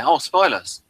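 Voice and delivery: ordinary talk, nothing marked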